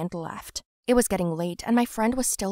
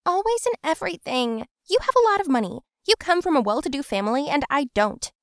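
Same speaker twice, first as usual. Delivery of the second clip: whiny envious friend; 7 semitones higher, 4 dB louder than usual. Acted. Voiced envious friend arguing.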